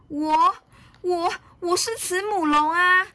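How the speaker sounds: very high, stammered protest; high storybook voice, taken aback; flustered little dinosaur. A narrator's reading. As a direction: protest in alarm as a small child. Frightened little dinosaur protests identity.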